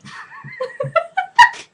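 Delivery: rising squeal of laughter; high squeal of laughter. Real, not acted. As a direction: let a squeal of laughter climb. Laughs at [name].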